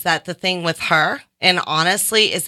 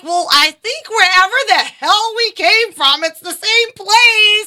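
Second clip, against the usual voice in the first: higher and louder, the last words slowed; mock earnest plea; 12 semitones higher, 5 dB louder than usual. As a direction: sell the big hopeful point. Imagined plea for everyone.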